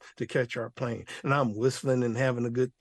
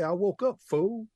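punchline retort; 6 semitones higher than usual. Retold or imitated. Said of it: "I said".

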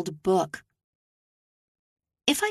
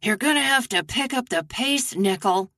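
higher, raised voice calling over the music; impatient older sister shouting over music; 4 semitones higher than usual. Acted. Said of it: "she shouts to be heard".

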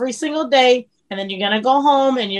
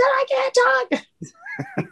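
high, slow, whiny mock sob; mock crying whine; much slower than usual. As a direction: whimper it like crying. Exaggerated whiny retelling.

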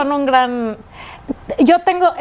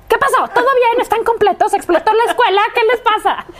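panicked mom; 8 semitones higher than usual. Retold or imitated. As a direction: fire them off in alarm. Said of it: "Y yo ya estoy así". (madre alarmada preguntando)

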